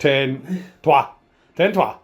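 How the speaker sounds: mock French accent, each word punched; playful French accent. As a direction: put on a French accent for fun. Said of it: "I'm more French each time".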